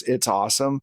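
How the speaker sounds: usual speaking voice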